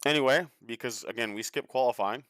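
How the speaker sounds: talking through a mouthful of chocolate; mouth full. Real, not acted. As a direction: speak with your mouth full. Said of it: "So I'm talking with my mouth full".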